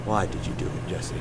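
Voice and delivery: very soft, near-whispered probing; hushed question. Acted. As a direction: ask it gently, almost under the breath. Soft question after breakdown.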